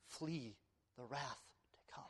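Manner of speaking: a breathy whispered warning; hushed whisper. Acted. Preacher whispering a warning to the unbeliever.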